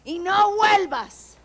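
shouting, furious Frida. Acted. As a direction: hurl it at him, loud. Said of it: "Nos gustaría hacerles un pedacito de eso".

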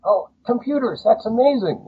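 high, delighted cries of lad; gushing like a thrilled old man calling on the phone; excited old actor. Retold or imitated. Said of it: "And he says".

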